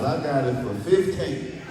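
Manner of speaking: usual speaking voice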